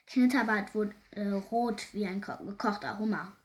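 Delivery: plain reading voice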